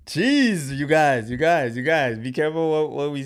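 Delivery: high, loud exclamation, half laughing; startled outburst. Real, not acted. Streamer blurts at chat after reading a risky comment, high loud yelp. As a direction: yelp it out, caught off guard by the chat.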